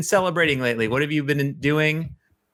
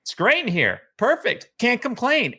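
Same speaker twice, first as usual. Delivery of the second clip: bright, pleased and carefree; cheery and content; 9 semitones higher than usual. Retold or imitated. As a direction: sound totally comfortable and happy. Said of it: "you're like".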